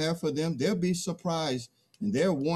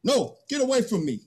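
angry, fast rebuff; 6 semitones higher, 4 dB louder, faster than usual. Acted. Man drives wolf away.

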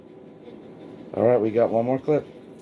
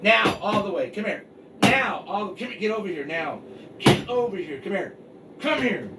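calling from far away; 7 semitones higher than usual. Real, not acted. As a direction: keep calling the dog over. Calling dog from distance.

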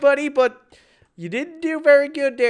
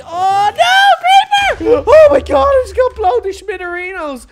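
very high, loud alarmed yell; panicked shriek; 12 semitones higher, 12 dB louder than usual. Real, not acted. Gamer blown up by a creeper, very high panicked yell. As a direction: scream as the creeper blows.

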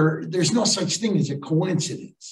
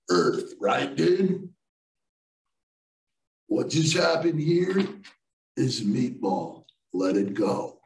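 lower, slow, lightly voiced old hippie, swelling on galaxy; hippie sage; 3 semitones lower than usual. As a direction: play the calm old hippie guru, a hint of it. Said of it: "He goes".

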